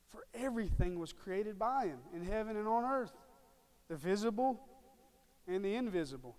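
emphatic scripture reading. Acted. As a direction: proclaim the verse, punching each phrase. Reads scripture aloud with force.

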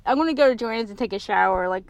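slurred, numb mouth speech; numb from the dentist. Real, not acted. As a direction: talk with a numbed, lopsided mouth. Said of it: "my mouth is numb".

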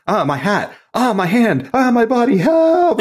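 mock panic. Acting scared invisible man.

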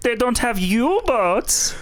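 high, slow mock German accent. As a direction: scoff in a comic German accent through laughter. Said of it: "that's a German accent".

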